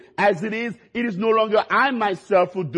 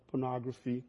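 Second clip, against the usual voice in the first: ordinary talk, nothing marked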